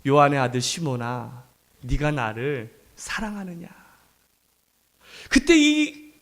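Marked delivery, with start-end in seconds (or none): 0.0-3.7 retold gentle voice of Jesus, lowered and unhurried; gentle, unreproachful. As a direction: ask quietly, without any blame. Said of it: "혼내지 않으셨어요".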